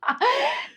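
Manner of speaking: high-pitched laugh. Real, not acted. Founder laughing after re-enacting her yell, high amused laugh. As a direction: laugh at your own outburst.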